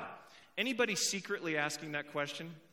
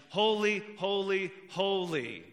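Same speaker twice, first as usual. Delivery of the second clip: each holy punched louder, building emphasis; emphatic triple holy; 4 semitones higher, 5 dB louder, slower than usual. Shown as a demonstration. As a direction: hit every holy hard. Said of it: "repetition is a key form of emphasis".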